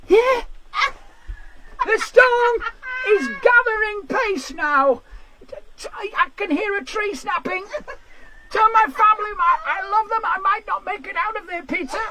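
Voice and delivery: breathless TV reporter in a gale, overacted; over-dramatic weather reporter. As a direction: play a reporter milking the storm for the camera. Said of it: "This is my impression of someone".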